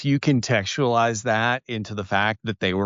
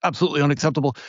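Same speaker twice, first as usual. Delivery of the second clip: fast, lightly hammed-up British fluster; mock British; much faster than usual. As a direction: play a flustered Brit apologizing in a rush. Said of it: "and they're like".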